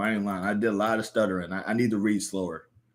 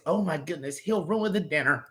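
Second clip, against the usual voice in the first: fussing grandma; 7 semitones higher than usual. Acted.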